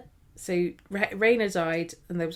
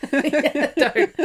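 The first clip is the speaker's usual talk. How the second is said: high loud laughing protest; 9 semitones higher, 10 dB louder than usual. Real, not acted. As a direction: laugh and plead don't. Laughing, begging no.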